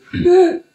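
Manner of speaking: a loud high burst of laughter; real laugh. Real, not acted. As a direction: laugh out loud mid-story. Laughing at cartoon story.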